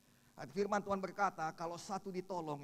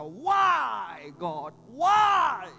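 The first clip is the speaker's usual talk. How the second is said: loud, high plea to God; anguished cry; 12 semitones higher, 17 dB louder, much slower than usual. Retold or imitated. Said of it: "dia berkata". (seruan putus asa kepada Tuhan)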